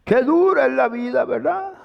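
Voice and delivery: a touch of syrupy pity from a comforter. Retold or imitated. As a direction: coo over him, mocking the pity. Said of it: "Y llega otro y le dice". (el hermano lastimero, con compasión empalagosa)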